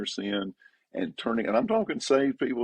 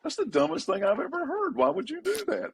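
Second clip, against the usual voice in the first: pitched way up, disbelieving; incredulous retort. Retold or imitated. Counselor's unspoken retort to a victim, high incredulous. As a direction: blurt out how stupid that was. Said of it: "I wanna say".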